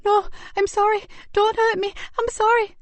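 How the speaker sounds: put-on high, frightened boy's cry; cried out in fear. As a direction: beg like a terrified boy in pain. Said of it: "cried out in fear and pain".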